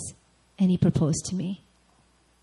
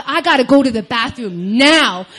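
louder, mildly desperate demand; urgent plea; 4 semitones higher, 6 dB louder than usual. Retold or imitated. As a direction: press it like you can barely hold it. Urgent retold demand for bathroom.

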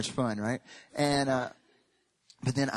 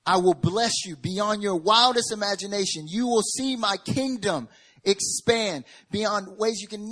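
building promise; 7 semitones higher, 8 dB louder than usual. Acted. God's promise voiced rising.

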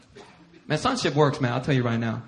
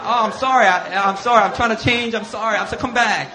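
pleading apology; 5 semitones higher, 7 dB louder than usual. Retold or imitated. Acts out apologising to departing students.

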